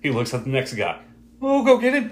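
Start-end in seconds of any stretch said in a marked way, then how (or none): 1.4-2.1 very high mock henchman voice; egging-on henchman. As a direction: parody a goon urging someone else to attack. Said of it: "bigger dude who just keeps saying".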